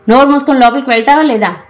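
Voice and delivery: mother shouting at her daughter to go inside; fast angry shout. A narrator's reading. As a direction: bark the order fast. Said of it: "అని మళ్ళీ అరిచింది".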